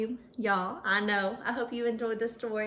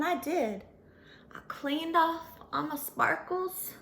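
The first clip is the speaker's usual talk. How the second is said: plain reading voice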